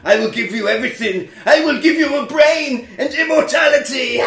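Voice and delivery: rising to a loud, high shout of grand promises; hammed villain. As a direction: tempt like a cartoon villain, building to a roar. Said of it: "Excellent villain work".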